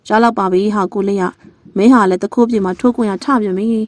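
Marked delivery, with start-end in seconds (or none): none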